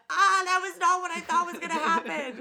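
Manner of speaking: high, loud, laughing through the words; laughing quote. Retold or imitated. Laughing at her own surprise to her friend. Quoting own amused reaction.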